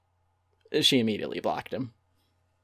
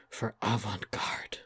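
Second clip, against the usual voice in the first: whispered, slow pretentious flourish; mock whisper; 3 semitones lower, 7 dB quieter, slower than usual. Mocking the chef's grand letter, whispered slowly. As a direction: breathe it like a sacred word.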